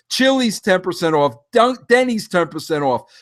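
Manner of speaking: ordinary talk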